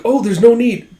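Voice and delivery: acted flustered refusal; hasty protest. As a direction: wave it off in a high, flustered voice. Character hastily declines in retelling.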